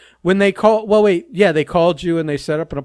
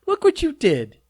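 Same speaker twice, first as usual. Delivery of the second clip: mocking tease; 11 semitones higher than usual. Real, not acted. Mocking partner for wrestling tweets.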